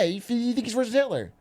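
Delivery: high, fast mock accusation; mock outrage, pitched high and rushed; imitating outraged critics. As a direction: sputter it like an indignant accuser. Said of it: "and they're not".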